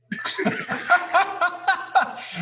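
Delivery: high, hearty burst of laughter; big laugh. Real, not acted. Guest recalling his manager's advice, hearty laugh.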